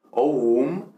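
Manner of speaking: "oum" read out as a Latin word. Shown as a demonstration. Teacher showing students how it is read, Latin reading. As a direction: sound out "oum" with a w-like glide into um. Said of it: "esto realmente lo leeríamos".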